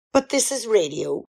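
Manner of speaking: ordinary talk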